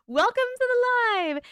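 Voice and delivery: high, loud cheery greeting; bright welcome. Real, not acted. Host welcoming a new viewer to the stream, high and loud. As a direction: sing out the welcome.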